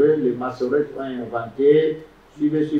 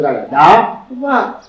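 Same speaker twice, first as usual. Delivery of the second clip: davar split and loud, second syllable stressed; syllable-stress demo; 4 semitones higher, 4 dB louder, much slower than usual. Shown as a demonstration. Teacher demonstrating a two-syllable word, stress on the last. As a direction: land firmly on the VAR of davar. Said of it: "Parce qu'il n'y a combien de syllabes".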